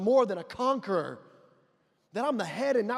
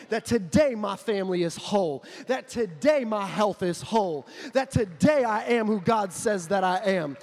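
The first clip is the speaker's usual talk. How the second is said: louder confident declaring; preacher leading bold confession, loud; building declaration; 4 dB louder than usual. Acted. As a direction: declare it with rising force.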